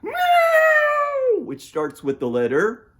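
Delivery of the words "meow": loud, very high drawn-out meow; yowling cat. Acted. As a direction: screech like a tripped-over cat. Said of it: "of course it goes".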